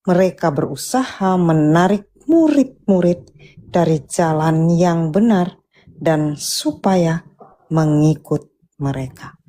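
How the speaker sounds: normal talking voice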